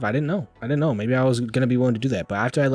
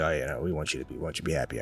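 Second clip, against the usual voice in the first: mock manager; 6 semitones lower, 7 dB quieter, faster than usual. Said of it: "They're like".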